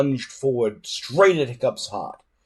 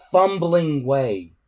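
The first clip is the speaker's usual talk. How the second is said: ordinary delivery, nothing marked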